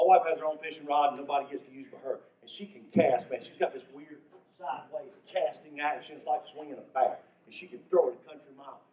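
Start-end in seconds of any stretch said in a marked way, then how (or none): none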